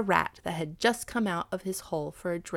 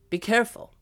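raised, louder warning; cautioning; 4 semitones higher, 6 dB louder, faster than usual. A narrator's reading. Dog cautioning the cat before the river crossing, warning. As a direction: warn firmly, pitched up. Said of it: "cautioned Blackfoot".

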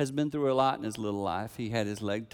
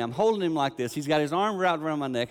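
usual speaking voice

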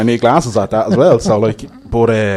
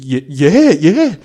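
high, loud startled yeses; louder, higher astonished agreement; freaking out; 6 semitones higher, 6 dB louder, much slower than usual. Retold or imitated. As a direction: imitate someone freaking out, rising. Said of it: "your man started freaking out".

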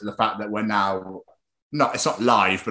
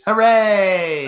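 loud, higher mock cheer; sarcastic hooray; 8 semitones higher, 6 dB louder than usual. Host mocking staying inside, a sarcastic cheer. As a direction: cheer with heavy irony.

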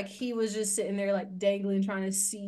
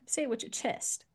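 ordinary talk, nothing marked

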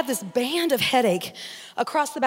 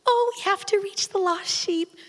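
sweet owner voice; 6 semitones higher, 4 dB quieter than usual. Retold or imitated. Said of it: "here's the owner".

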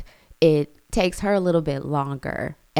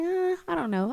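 daughter's voice. Retold or imitated. Daughter answering mom about tryouts, high and hesitant. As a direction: shrug it off like a reluctant girl. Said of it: "She was like".

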